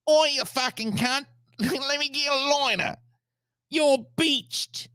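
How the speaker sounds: very high, nasal cartoon New Zealander; squeaky-high, exaggerated New Zealand twang; nasal Kiwi accent. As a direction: pinch it through the nose as a mock Kiwi. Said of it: "Kiwi is an Australian with a little bit nasalier voice".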